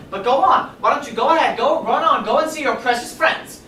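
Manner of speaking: loud, high, wounded send-off; furious dismissal. Acted. Mother sending her son off to his friends, furious. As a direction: drive them away with scornful hurt.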